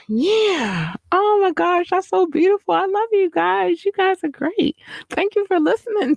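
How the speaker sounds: very high, gushing thank-you voice; sweet falsetto. Pretend. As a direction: melt into a tiny high coo.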